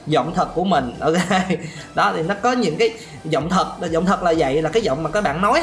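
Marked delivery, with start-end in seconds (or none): none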